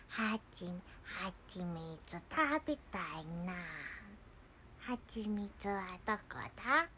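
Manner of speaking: soft, dopey bear voice; Winnie the Pooh. Retold or imitated. Bear wondering where the honey is, soft mumbling voice. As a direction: murmur it softly like a cartoon bear. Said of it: "プーさんのモノマネをした人の声".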